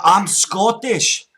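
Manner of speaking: put-on loud, slow, high Scottish accent; put-on Scots, drawn out and loud; broad Scottish accent. Prank caller to scammer, broad Scottish.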